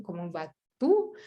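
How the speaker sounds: "comment vas-tu" said slowly, rising on "vas-tu"; slow "comment vas-tu" ending in a rise; slow careful French. Shown as a demonstration. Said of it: "ngomongnya comment". ("comment vas-tu" pelan dengan nada naik)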